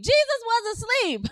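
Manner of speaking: high, emphatic preacher's call; wake-up shout. Real, not acted. Preacher making sure y'all not asleep, high wake-up call. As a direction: rouse a drowsy congregation. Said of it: "Just making sure y'all not asleep".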